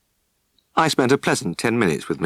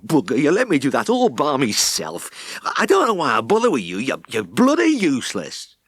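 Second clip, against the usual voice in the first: frustrated rage; 5 semitones higher than usual. Acted. Fallen farmer raging at his idle helper, furious. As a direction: scold the lazy helper from the floor. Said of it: "glared up at him with frustrated rage".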